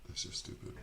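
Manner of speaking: whispered under the breath; whispering. Real, not acted. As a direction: whisper it to yourself. Muttered aside to self.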